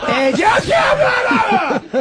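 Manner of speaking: long, high garbled mock scream; holy-shit rage. Fan re-enacting the actor's rage, prolonged high shout. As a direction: imitate someone snapping, drawing the shout right out. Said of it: "his rage is like that real fucking holy shit rage".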